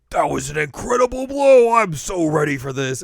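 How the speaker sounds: high, loud hammed-up fighter; fighter impressed. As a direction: boast it like a pumped-up fighter. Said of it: "is like".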